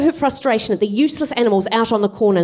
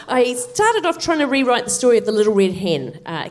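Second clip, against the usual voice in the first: ordinary delivery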